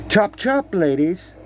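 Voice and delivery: snappy mock command; bossy call. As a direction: hurry the ladies along. Bossy call to court ladies.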